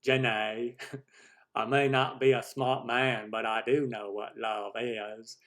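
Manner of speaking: slow amateur Southern drawl; Forrest Gump. Acted. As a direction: do a halting Southern simpleton impression. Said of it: "Forrest Gump accent".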